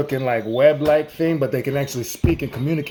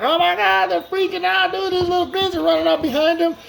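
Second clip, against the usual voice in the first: freaking out. Acted. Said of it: "Joe and Smith are like".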